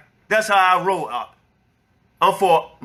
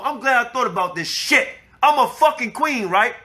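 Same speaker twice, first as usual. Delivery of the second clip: ordinary talk, nothing marked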